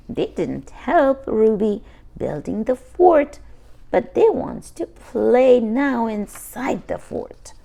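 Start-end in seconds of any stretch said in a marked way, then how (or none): none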